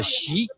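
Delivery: "xi" rising in pitch to a high end; second tone. Shown as a demonstration. Guide teaches viewers the tones, "xi" in rising second tone. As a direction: Mandarin second tone, lift "xi" upward. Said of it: "And the second tone is a".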